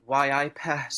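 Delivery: put-on a light Geordie accent doing [name]; [name] Geordie impression. Guest does a [name] impression for the hosts in Geordie. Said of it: "your best Geordie's impression now".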